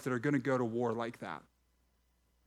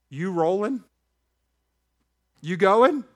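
loud challenge; 6 semitones higher, 8 dB louder than usual. Real, not acted. Pastor challenging the congregation, loud and rising. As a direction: pitch jumps up, loud, demanding an answer.

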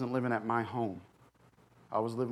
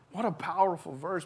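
usual speaking voice

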